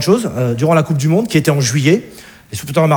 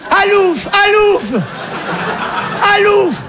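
shooing shout; 11 semitones higher, slower than usual. Acted. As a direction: shoo him away with the ham. Mother chasing with ham. (cri pour chasser, très aigu et fort)

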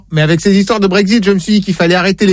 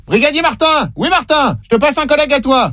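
raised-pitch call to someone nearby; calling someone over; 6 semitones higher than usual. Pretend. Prankster pretending to hand the phone to his brother-in-law, calling out. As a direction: pretend to summon a colleague, voice up high. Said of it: "je vous le passe".

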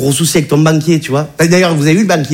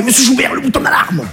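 high, urgent whisper-like plea; panicked banker; 8 semitones higher than usual. Retold or imitated. Banker during a hostage situation, pleading in a high panicked voice. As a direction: hiss the alarm button line in panic, a touch mock. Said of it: "il m'a fait".

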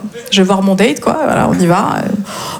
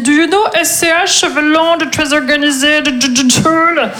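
slow, higher, stumbling pupil voice; élèves baragouinant l'anglais; 4 semitones higher, slower than usual. Acted. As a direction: stumble slowly through broken English. Imite les élèves parlant aux Polonais. (voix d'élève qui bafouille lentement)

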